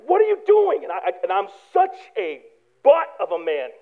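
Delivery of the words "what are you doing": higher-pitched alarmed question; horrified family. Retold or imitated. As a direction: ask in shock as the family reels. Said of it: "everyone's horrified".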